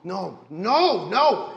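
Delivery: high, loud no, no, no, rising in panic; frantic NPC shout. Acted. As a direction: stop them in sheer alarm. NPC protests burial.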